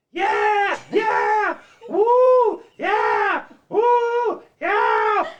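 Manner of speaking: high, loud fan shouts; concert screaming. Retold or imitated. Fan screaming at her own concert footage. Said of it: "I'm screaming".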